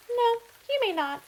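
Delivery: very high, loud squeak; mouse refusal. Acted. Mouse refuses Gus, high squeaky refusal. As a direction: refuse flatly.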